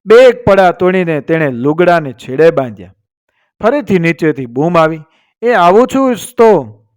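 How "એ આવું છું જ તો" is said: higher-pitched, mildly impatient reply; calling back. A narrator's reading. Young wife answers the shout from below, calling back. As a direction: call back down, only slightly raised.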